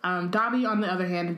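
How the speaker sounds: ordinary talk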